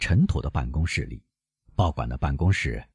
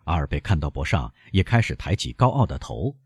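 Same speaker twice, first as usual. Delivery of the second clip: ordinary delivery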